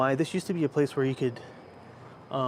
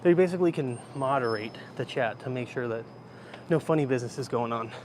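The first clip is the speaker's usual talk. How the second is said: normal talking voice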